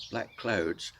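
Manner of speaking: usual speaking voice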